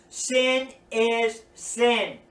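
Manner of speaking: slow, deliberate and firm; slow, stern statement; blunt truth. Acted. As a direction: lay it down plainly, word by word. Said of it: "We need to be able to tell people".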